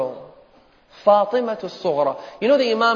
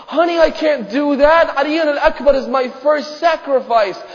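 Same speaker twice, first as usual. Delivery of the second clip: high, loud protesting plea; preacher acting an anguished father; impassioned father's refusal; 9 semitones higher, 4 dB louder than usual. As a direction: refuse the child with raised, fervent voice. Father refusing his daughter.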